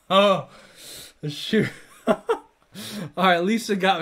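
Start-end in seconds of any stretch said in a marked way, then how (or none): 0.0-2.1 real laugh breaking through. Real, not acted. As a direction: burst out laughing with a loud "oh". Caught laughing at a joke.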